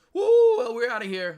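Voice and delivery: big high whoop of joy; excited whoop. Real, not acted. Trader cheering his stock taking off. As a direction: cheer the breakout loudly.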